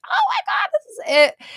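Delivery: high, loud eureka squeal; excited realization. Retold or imitated. Retelling the moment the idea came, squealed high and loud. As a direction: squeal it like the idea just landed. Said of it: "I was like".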